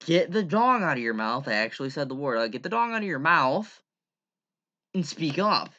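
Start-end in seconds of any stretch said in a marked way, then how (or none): 0.1-1.5 louder, higher exasperated demand; raised-voice mock scolding; retold scolding. Retelling his scolding voice message. 2.5-5.7 raised, higher-pitched exasperated order; louder mock telling-off; retold scolding. Retelling his scolding voice message.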